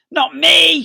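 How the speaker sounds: loud, high scared yelp; panicked scream. Acted. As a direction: scream it and flee. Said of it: "screamed Bunce".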